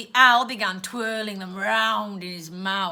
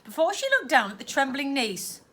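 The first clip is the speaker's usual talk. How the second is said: ordinary delivery, nothing marked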